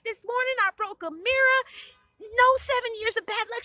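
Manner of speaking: high, loud, gleeful announcement; excited recounting. A narrator's reading. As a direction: debunk the omen triumphantly. Heroine excitedly tells family everything.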